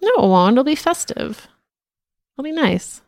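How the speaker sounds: high, bright upbeat insistence; cheerful. Real, not acted. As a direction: brighten it up, insist it's fun. Cheerful contradiction of sad.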